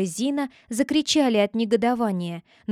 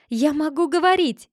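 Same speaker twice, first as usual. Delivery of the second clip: high, loud shout of joy; loud joyful cry; 6 semitones higher, 3 dB louder than usual. Acted. Roselina crying out to the court that she can speak, loud and joyful. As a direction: burst out with delight. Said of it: "громко закричала Розелина".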